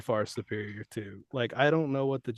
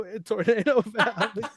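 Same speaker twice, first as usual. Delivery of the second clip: laughing while talking; 10 semitones higher, 7 dB louder than usual. Real, not acted. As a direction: laugh through it. Laughs mid-line.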